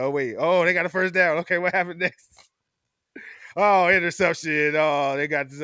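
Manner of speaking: a fan reading game updates, mock excited; imitating himself watching a game. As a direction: react to each play as it happens. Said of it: "I gotta be like".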